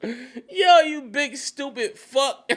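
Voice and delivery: high, loud, drawn-out insult through laughter; real helpless laughter in the words; laughing mockery. Real, not acted. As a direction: crack up while jeering. Laughing at the character's stupidity.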